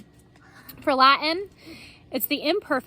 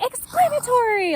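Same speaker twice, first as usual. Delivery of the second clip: high, loud jump-up shout; big excited cry; excited exclamation; 12 semitones higher, 7 dB louder than usual. Shown as a demonstration. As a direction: burst out with it like an exclamation point. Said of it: "expressing excitement".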